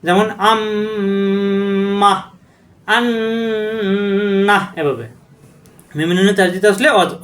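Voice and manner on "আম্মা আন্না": আম্মা and আন্না with the doubled m and n held long through the nose; nasal ghunna demo. Shown as a demonstration. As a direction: hum the মম in আম্মা and নন in আন্না slowly. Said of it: "অজীব গুণ্য হবে".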